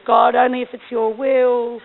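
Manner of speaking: slow, loud, put-on cautious prayer; hedging prayer. Missionary mocking her own hedged prayer, slow and loud. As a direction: pray it slowly, hedging every word. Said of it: "one of those prayers that hedges your bets".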